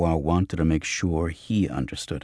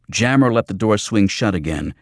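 ordinary delivery

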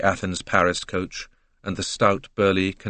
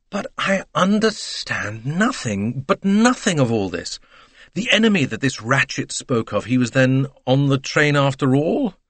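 higher, louder, frantic bewilderment; exasperated; 8 semitones higher, 6 dB louder than usual. Acted. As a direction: throw up his hands in despair. Bouc bewildered, pleading to Poirot.

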